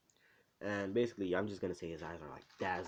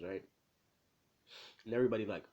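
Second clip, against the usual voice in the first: ordinary talk, nothing marked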